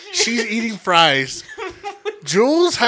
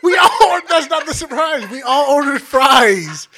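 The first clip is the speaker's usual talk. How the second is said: disbelieving rant; 8 semitones higher, 4 dB louder than usual. Retold or imitated.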